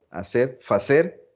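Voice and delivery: usual speaking voice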